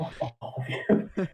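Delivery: slow whispered curse; whispered aside. Retold or imitated. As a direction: breathe it out slowly under your breath. Sarcastic muttered aside to crowd.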